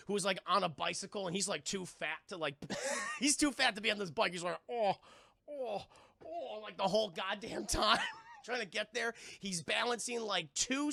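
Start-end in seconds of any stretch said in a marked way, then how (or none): 4.7-6.6 imitated huffing moans of effort; mock straining groans. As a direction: groan as if labouring on pedals. Imitates overloaded man on bike.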